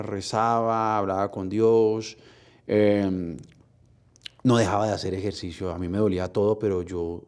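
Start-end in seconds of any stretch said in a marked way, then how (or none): none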